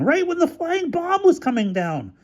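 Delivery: very high-pitched complaint; outraged, way up high; angry accusation. Acted. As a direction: press the charge indignantly. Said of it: "accused Featherstone".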